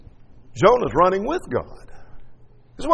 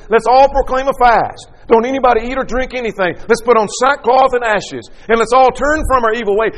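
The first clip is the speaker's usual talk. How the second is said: a king calling his city to fast, higher and louder; king's decree; 7 semitones higher, 4 dB louder than usual. Acted. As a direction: proclaim it like a king rallying his people. Preacher voices the king's decree.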